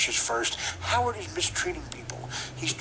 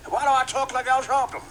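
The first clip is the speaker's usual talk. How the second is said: high, loud cartoon rooster bluster; hammed-up preacher-rooster voice; Sharpton Leghorn; 10 semitones higher, 5 dB louder than usual. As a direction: boom it out like a blustering cartoon bird. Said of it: "Sharpton Leghorn".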